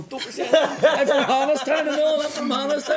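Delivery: high, loud laughing plea; mock panicked protest. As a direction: squeal it like a lad trying to get out of trouble. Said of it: "And Ian was going".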